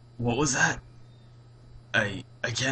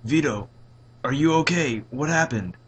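loud, higher, urgent questions; worried father; 5 semitones higher, 10 dB louder than usual. A narrator's reading. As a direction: press your son for an answer. Worried father questions shaken son.

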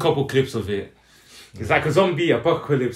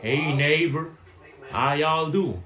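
raised-pitch mock Texan greeting; hammy, unconvincing Texas accent; bad Texas accent; 4 semitones higher, much slower than usual. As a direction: badly fake a Texan hello. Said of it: "Your accent is terrible".

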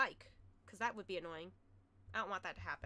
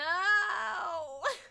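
loud anguished laughing no; loud, high laughing cry; laughing shout; 6 semitones higher, 6 dB louder than usual. Real, not acted. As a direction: howl in mock despair. Reacts to meme, shouting.

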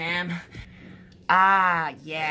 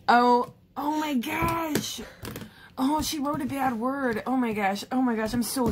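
shocked; 8 semitones higher than usual. Acted. Discovers sister's bad word.

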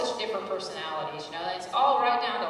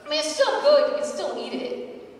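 leaps much higher and louder, then slows; mock defensive; 10 semitones higher, 9 dB louder than usual. Shopper defending clearance meat, squeaky and defensive. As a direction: defend it a bit too eagerly.

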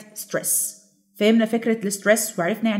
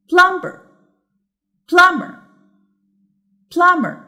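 plumber with PLUM high and loud; correct first-syllable stress; 8 semitones higher, 6 dB louder, much slower than usual. Shown as a demonstration. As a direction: punch PLUM in plumber for the listeners. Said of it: "which has the correct".

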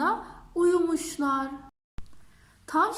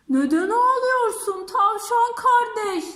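caring elephant; 5 semitones higher, 6 dB louder than usual. Acted. Elephant asks the crying rabbit.

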